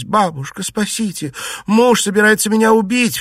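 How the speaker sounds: high-pitched panicked plea; frightened, urgent high cry; desperate pleading. A narrator's reading. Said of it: "взмолилась".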